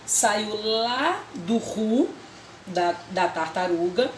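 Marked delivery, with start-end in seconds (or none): 0.0-2.1 ru stretched out slowly as a syllable; syllable demo. Shown as a demonstration. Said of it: "que saiu de onde?". (sílaba ru bem devagar)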